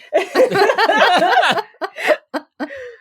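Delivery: high, sustained burst of laughter. Real, not acted. Teller cracks up after acting out the outburst, laughing. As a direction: crack up for real.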